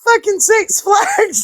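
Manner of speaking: slow, laughing and crying cry-out; laughing shout. Real, not acted. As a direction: blurt it while laughing.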